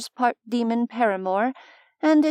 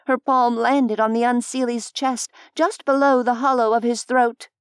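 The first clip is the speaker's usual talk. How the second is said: usual speaking voice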